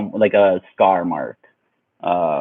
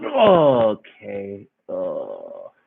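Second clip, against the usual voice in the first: dazed mock groan; 3 semitones lower, much slower than usual. Retells lying dazed after punch.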